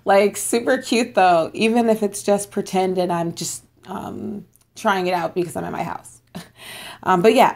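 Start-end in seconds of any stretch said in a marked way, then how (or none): none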